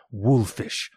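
ordinary delivery, nothing marked

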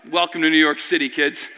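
put-on low, dry aside to the kids; deadpan welcome. Dry aside after the brawl.

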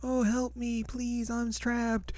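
high, breathy-thin fake victim voice; hammed-up damsel cry for help; mock damsel in distress. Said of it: "Kyoryu's just like".

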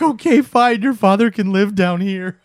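high, loud tearful surrender; mock crying. Crying husband agrees father can live downstairs, mock tearful. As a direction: give in with a hammed-up wail. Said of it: "'Cause he's still crying".

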